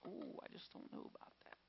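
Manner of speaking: whispered, hesitant ooh; timid whispered reluctance; doubtful whisper. Retold or imitated. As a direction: breathe it out unsure and nervous.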